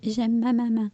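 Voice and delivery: lightly put-on talking-pet voice; a hint of a talking animal; voicing Boris. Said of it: "faut que tu nous dises le mot de la fin".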